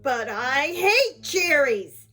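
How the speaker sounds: loud, slow, drawn-out protest; angry shout. A narrator's reading. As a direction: tantrum yell, stretching the words. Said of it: "shouted Angry".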